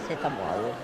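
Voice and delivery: low, soft embarrassed mumble; mumbled. Real, not acted. Embarrassed mumble to the stranger, low and soft. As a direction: swallow the words.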